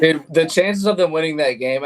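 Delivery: laughing through disbelief; chuckling while talking. Real, not acted. Laughs at lucky win.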